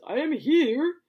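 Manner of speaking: high, loud announcement; loud, high, grand declaration; grand entrance. Retold or imitated. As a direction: proclaim your arrival grandly. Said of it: "and he says".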